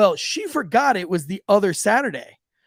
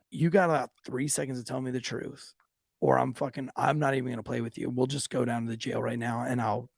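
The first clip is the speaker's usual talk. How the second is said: bluffing threat; 5 semitones lower, 6 dB quieter than usual. Retold or imitated. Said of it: "trying to bluff her".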